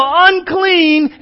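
high, loud acted warning yell. Imagined leper going down the arcade, yelling. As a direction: shout it across a crowd. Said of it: "and you yell".

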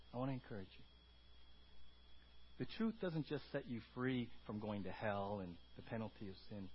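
ordinary delivery

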